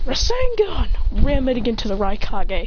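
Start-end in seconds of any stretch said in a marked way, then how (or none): 0.1-0.9 high-pitched anime attack call; mock battle cry. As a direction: sing out the special move, high. Attack repeated in fight.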